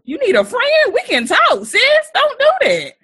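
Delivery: high, loud, teasing concern; hammed-up high "sis" intervention, loud; mock sisterly concern. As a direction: playfully console a friend oversharing. Mock consoling a crier.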